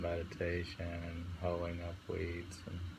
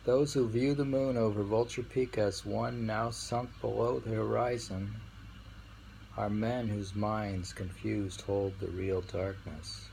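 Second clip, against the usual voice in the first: poem reading; 3 semitones higher, 4 dB louder than usual. Acted. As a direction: proclaim the verse gravely. Rereads the verse.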